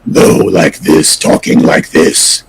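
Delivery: low, slow, breathy creature voice; Angel of Death voice. Shown as a demonstration. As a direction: drop it deep and draw it out in a hoarse whisper. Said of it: "another pass on the".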